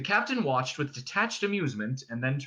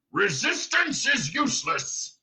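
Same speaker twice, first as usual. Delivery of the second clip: slow, raised dim-brute bellow; Vogon guard shouting; 8 semitones higher, slower than usual. A narrator's reading. Guard ignoring the prisoner's retort, shouting slowly. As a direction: bellow the stock phrase, stretched out. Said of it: "the guard shouting again".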